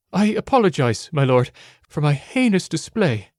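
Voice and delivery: high, deferential character voice; formal, humble rabbit girl; apologetic rabbit girl. Acted. Rabbit girl apologizes to her lord.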